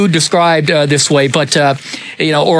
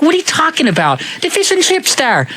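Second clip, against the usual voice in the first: much higher mock Irish scolding; high, mocking Irish lilt; exasperated Irish cousin; 12 semitones higher than usual. Cousin scolding Jeffrey in Irish accent.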